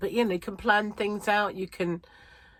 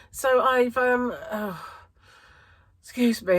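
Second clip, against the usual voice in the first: slightly out of breath; breathless, catching air between words; 5 semitones higher, 6 dB louder, slower than usual. Real, not acted. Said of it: "I'm a bit out of breath".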